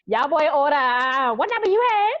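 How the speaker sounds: very loud, high Charleston holler; drive-through window worker. Retold or imitated. Imitating the Checkers worker heard with mama and daddy, loud hometown call. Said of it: "all I heard was that coming out of the window".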